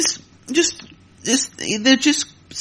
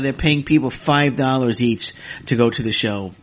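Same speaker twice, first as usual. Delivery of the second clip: ordinary talk, nothing marked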